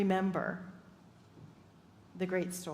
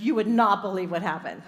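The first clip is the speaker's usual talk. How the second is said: louder, breathless excitement; eager news; 2 semitones lower, 8 dB louder than usual. Retold or imitated. Woman tells minister her story, loud excitement. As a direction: blurt out amazing news. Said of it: "she's like".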